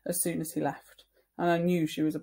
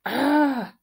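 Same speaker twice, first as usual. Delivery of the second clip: scared yelp; 8 semitones higher, 9 dB louder than usual. Retold or imitated. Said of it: "I was like".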